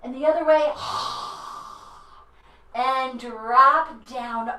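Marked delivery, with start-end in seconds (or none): 0.8-2.0 scary lizard hiss. Shown as a demonstration. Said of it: "let's be a scary lizard".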